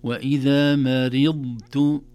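وإذا مرضت recited slowly with a full heavy dad held apart from the ta; clear dad. Shown as a demonstration. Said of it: "تسمعون صوت الضاد".

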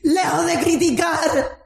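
impassioned preaching. Acted. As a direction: preach it hard to the congregation. Preaching at full force. (predicación fervorosa a voz en cuello)

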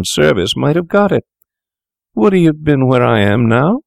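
high, desperate pleading; anguished cry. A narrator's reading. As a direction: cry out in panic and despair. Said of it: "he cried".

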